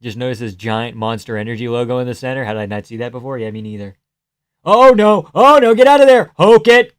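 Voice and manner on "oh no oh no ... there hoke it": high, loud cry of alarm; alarmed shouting. Real, not acted. Viewer urging fighter to get out of there, alarmed shouting. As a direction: yell a real urgent warning to the fighter.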